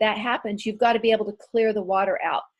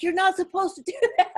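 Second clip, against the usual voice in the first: instructor freaking out; 8 semitones higher, faster than usual. Retold or imitated. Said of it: "freaking out at me".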